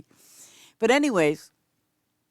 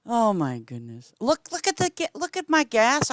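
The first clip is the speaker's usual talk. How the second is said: ordinary talk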